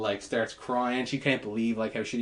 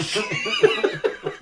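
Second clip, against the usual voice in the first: high laugh; 3 dB louder than usual. Real, not acted.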